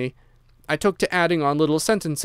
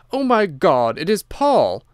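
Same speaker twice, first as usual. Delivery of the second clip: shocked cry; 4 semitones higher, 5 dB louder, slower than usual. A narrator's reading. Woman sees the prisoner, shocked.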